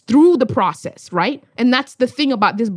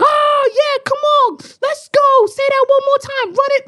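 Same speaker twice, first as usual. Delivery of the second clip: hyped crowd; 13 semitones higher than usual. Retold or imitated. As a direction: egg the poet on like a rowdy crowd.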